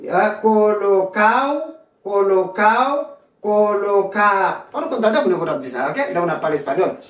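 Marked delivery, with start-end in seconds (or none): 0.4-3.0 colocao with A gliding straight into O; "co-lo-CAO", stretched and lifted at the end; dropped D. Shown as a demonstration. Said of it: "ou qu'à prononcer ou qu'à pas". 3.4-4.6 colocar said "co-lo-CAA", a long final A, no R; dropped D. Shown as a demonstration. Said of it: "notre deuxième lettre".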